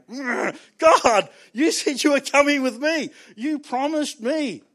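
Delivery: hurt inner plea. Retold or imitated. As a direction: beg God like a let-down child. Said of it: "inside you're going".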